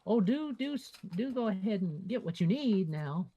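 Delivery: high, fluttery hurried shopkeeper; flustered shopkeeper voice. Retold or imitated. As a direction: shoo the customer along politely. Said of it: "she kind of like".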